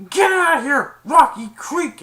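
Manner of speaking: high, loud dismissal; angry yell. Retold or imitated. Chasing off a Rocky Creek fan, angry shout. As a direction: imitate someone chasing off a rival. Retells yelling off rival fan.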